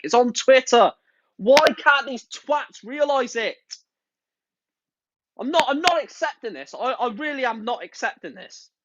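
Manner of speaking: exasperated outrage. Real, not acted. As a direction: spit out each word, livid. Furious rant about Super League.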